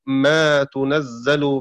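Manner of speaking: تنزل with a clear u on the ta; damma on ta. Shown as a demonstration. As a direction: recite تنزل slowly as "tunazzalu". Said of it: "بضم التاء".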